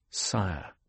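soft, low musing; quiet word said inwardly; inward thought. A narrator's reading. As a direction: think it to yourself, hushed and low. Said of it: "Paul thought".

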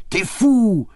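high, loud French exclamation; excited cry. A narrator's reading. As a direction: fling it at him gleefully. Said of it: "She got so excited".

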